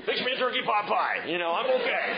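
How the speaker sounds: very high, laughing mock-brave voice; husband sulking to wife, hammed-up; sulky husband. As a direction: pretend to be fine while sulking. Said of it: "unless their face is turned to the wall".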